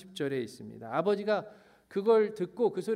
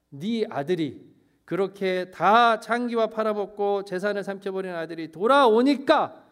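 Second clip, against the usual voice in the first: acted accusation, higher and louder; rough, angry; 4 semitones higher, 6 dB louder than usual. Elder son accuses his returning brother to father, rough and angry. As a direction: build indignantly to a loud finish. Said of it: "라고 너무너무 거칠게".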